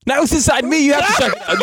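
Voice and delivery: high, hammed-up frantic plea; mock panic. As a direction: fake alarm, pleading for help.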